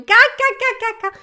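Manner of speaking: high, loud imitated guffaw; mocking cackle. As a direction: fake a jeering "ha-ha-ha" at someone. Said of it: "as pessoas começam a rir". (gargalhada de deboche imitada)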